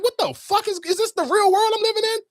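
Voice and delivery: very high-pitched incredulous outburst; outraged disbelief. Retold or imitated. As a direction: retell it as a squeaky, flabbergasted question. Retold outrage at family's calm.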